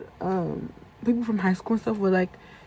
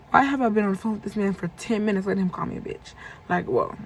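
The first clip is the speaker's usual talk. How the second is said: normal talking voice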